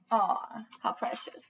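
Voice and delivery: near-whispered coo, breathy and fond; tender aside. Real, not acted. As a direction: melt over the picture, almost whispered. Teacher cooing over book pictures.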